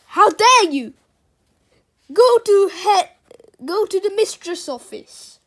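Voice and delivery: loud, high furious shouting; indignant, full-voiced telling-off; outraged scolding. Furious teacher scolds Peter.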